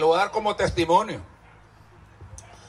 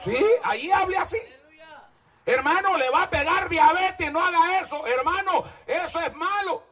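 higher sarcastic pleading; mocking high preaching; 9 semitones higher than usual. Real, not acted. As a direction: mimic the nagging warning.

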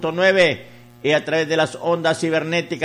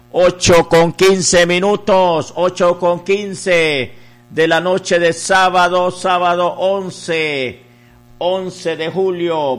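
loud, ringing announcer delivery; radio time call; 6 dB louder than usual. Real, not acted. Radio host giving listeners the Saturday night time, loud announcement. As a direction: belt out the time and date on air.

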